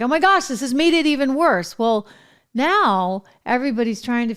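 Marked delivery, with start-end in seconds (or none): 0.0-1.8 higher, louder mimicked exasperation; mock freak-out. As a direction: imitate a palace in a panic, pitched up and louder. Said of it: "freaking out".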